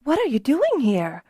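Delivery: high, startled question; surprised. A narrator's reading. Kelsa finds Beeman at the Harper table, high surprised question. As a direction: caught off guard at the table. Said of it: "Kelsa asked Beeman in surprise".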